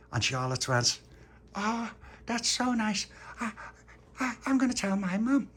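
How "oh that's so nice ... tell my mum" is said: put-on sweet little girl. Charlotte reacts sweetly to the plan.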